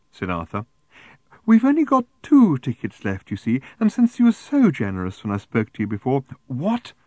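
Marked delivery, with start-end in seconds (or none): 1.5-6.2 high, loud, gushing pitch; prim raffle seller. A narrator's reading. Woman returning with last tickets, prim and gushing. 6.5-6.9 high, sharply rising question; snapped. A narrator's reading. Arthur interrupted by the seller, snapping. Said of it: "snapped Arthur".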